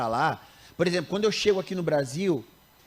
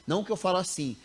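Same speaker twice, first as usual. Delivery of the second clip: ordinary talk, nothing marked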